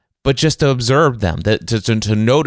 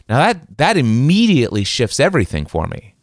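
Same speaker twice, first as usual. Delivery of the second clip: usual speaking voice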